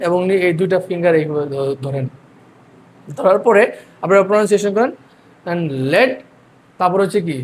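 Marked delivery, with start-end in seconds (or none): 5.9-6.1 "লেড" as a separate first syllable. Shown as a demonstration. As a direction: say "লেড" alone, then stop before the next syllable. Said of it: "আমরা ভেঙে ভেঙে". ("লেড" ভেঙে আলাদা সিলেবলে)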